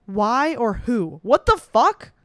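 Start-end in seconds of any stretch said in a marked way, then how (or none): 1.2-2.1 higher, louder cry of confusion; loud bewildered exclamation; baffled outburst. Real, not acted. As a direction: yelp at the absurd question. Baffled by odd quiz question.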